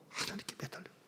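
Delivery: barely audible breath; faint whisper. Acted. Pastor repeating the plea in a faint whisper. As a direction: let it fade almost to nothing.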